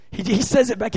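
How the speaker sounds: ordinary talk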